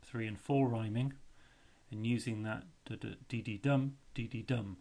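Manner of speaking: teacher's lively closing to the class; energetic recap. Real, not acted. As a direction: wrap up with enthusiasm. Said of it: "recap after the film".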